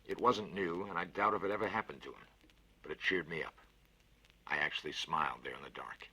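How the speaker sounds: ordinary delivery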